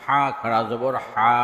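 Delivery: final "হা" held long; drawn-out vowel. Shown as a demonstration. Teacher demonstrating khara jabar, long "হা". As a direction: stretch the last "হা" for one alif. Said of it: "একালিপ টেনে পড়তে".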